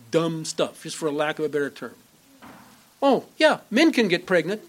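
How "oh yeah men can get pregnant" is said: high mocking "Oh, yeah" in a scoffing put-on voice; mock claim. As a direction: parody the gullible, voice pitched up and louder. Mocking imagined believers.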